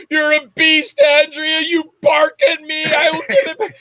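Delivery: high, loud mock outburst; alarmed shouting. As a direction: holler up high at a frightening beast. Acts yelling at the barking dog.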